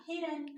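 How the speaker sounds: हिरन said high and bright with a short इ; answer hiran. Shown as a demonstration. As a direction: announce हिरन as the answer, pitch up. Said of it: "क्या बना?". (उत्तर देते हुए हिरन)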